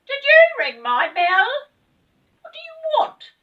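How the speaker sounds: very high, loud elf voice; a little elf demanding answers. Acted. Elf at her door.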